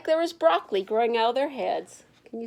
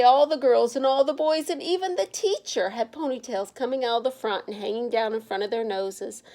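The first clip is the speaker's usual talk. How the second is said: plain reading voice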